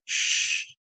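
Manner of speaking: a breathy shush, no voice at all; soothing whispered shh; soft shush. Retold or imitated. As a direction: hush a crying friend gently. Jesus hushes the crying narrator.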